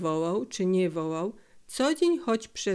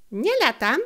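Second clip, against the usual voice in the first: denying; 5 semitones higher, 5 dB louder than usual. Acted. Felek denying, squabble.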